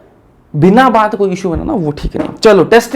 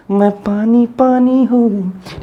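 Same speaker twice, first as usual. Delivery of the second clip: mock patient voice; 4 semitones higher, much slower than usual. Joking voice of a cholera patient.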